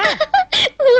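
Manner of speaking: high amused laugh; giggle. Acted. Woman amused by the man's remark, giggling. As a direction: let a giggle slip out. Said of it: "ఏమిటా నవ్వు".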